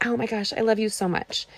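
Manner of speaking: usual speaking voice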